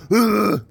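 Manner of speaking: short sharp mock grunt; imitated tiger grunt. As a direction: grunt it like a big cat. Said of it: "that's an intense sound".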